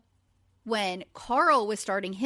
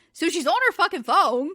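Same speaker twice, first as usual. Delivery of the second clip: outraged; 5 semitones higher, 4 dB louder than usual. Real, not acted. Outraged at Amanda ignoring texts.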